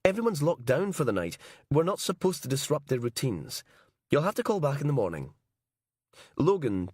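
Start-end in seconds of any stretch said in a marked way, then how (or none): 0.0-5.3 higher, sharp woman's voice on the phone; irritated night receptionist. Acted. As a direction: scold the late caller from a higher register.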